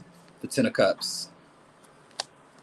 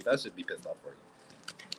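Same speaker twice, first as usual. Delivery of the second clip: much softer, slow self-correction; muttered aside; 7 semitones lower, 13 dB quieter than usual. Real, not acted. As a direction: mumble the fix under your breath.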